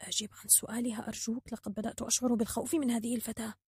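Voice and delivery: very soft, hushed plea; timid whispered urging; fearful whisper. A narrator's reading. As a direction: beg under your breath, scared. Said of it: "تدخل الحكيم بخوف هامسا".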